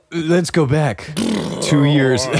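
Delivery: slow, eerie, drawn-out voice; unearthly voice. Pretend. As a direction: intone it like a ghostly flashback. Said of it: "spooky dramatic rewind, then redone".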